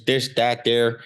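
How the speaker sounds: South Side Chicago accent, th turned hard; Irish South Side Chicago accent. Shown as a demonstration. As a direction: demonstrate a Chicago accent on each little word. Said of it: "so that accent always".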